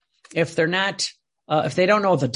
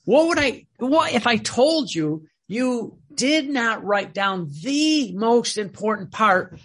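raised, slow mock reproach, higher pitched; voicing Jesus; 6 semitones higher, slower than usual. Preacher voicing Jesus scolding Louisa, slow high reproach. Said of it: "He goes".

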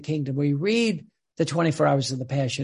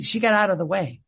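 ordinary delivery

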